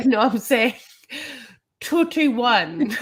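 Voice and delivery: laughing through a quote. Retold or imitated. Laughing while quoting the wife.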